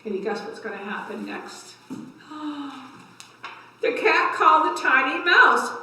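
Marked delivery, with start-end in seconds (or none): 1.9-2.9 surprised gasp. Pretend. Building suspense for children.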